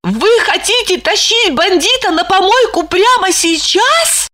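high shocked question; astonished. A narrator's reading. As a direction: gasp it out in disbelief. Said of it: "Липочка поразилась".

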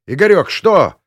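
high, loud urgent question; alarmed shout. A narrator's reading. Rushing to an unresponsive pilot, alarmed shout. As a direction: call out in alarm. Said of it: "крикнул я, вскочив с кресла".

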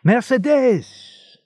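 slow, high, loud call of her name; happy shout again. A narrator's reading. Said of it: "a voice shouted happily".

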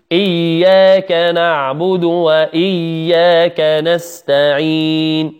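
slow chanted Fatiha; tajweed recitation; Quran recitation. Acted. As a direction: recite the verses slowly for the class.

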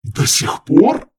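high, loud, slow roar. A narrator's reading. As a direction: bellow it out in disbelief. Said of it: "взревел наместник". (громкий возмущённый рёв)

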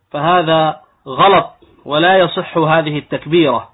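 ordinary delivery

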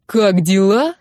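incredulous echo, starting to build. A narrator's reading. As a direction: raise the voice a little, indignant question. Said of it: "Голос его стал чуть громче".

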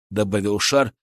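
ordinary delivery

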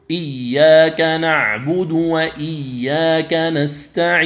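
slow recitation of إياك نعبد وإياك نستعين with the final ن held and doubled; wrong doubled nun. Shown as a demonstration. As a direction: drag the ن of نستعين into a long nasal shadda. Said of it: "هذا خطأ".

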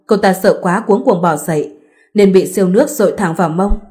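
plain reading voice

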